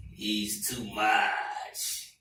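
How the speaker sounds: put-on drawn-out Ghanaian accent, pitched low. Ghanaian coworker repeats his Trump verdict, slow grave Ghanaian accent. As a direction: imitate a grave Ghanaian, very slowly. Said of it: "Just in case y'all didn't hear me".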